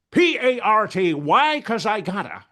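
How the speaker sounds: manic high, loud cartoon party shout; over-the-top comic movie outburst; Jim Carrey. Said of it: "when I do Jim Carrey".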